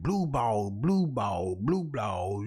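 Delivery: deep droning flashback refrain; flashback echo. Retold or imitated. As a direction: intone it low like a dream echo. Flashback echo voiced by Jesus.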